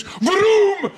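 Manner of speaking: loud high car roar; engine vroom. Pretend. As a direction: shout it like a revving engine. Daily Star headline as engine noise.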